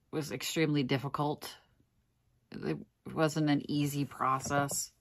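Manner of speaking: ordinary talk, nothing marked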